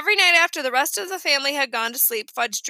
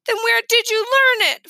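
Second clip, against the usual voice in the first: put-on high, louder childish question; pestering child; 10 semitones higher, 5 dB louder than usual. A narrator's reading. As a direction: push dad like a nosy kid. Curious child presses dad.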